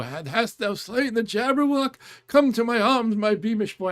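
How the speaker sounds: put-on high, loud creaky old man. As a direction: cry out like an excited old man. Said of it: "I'm doing an old man voice".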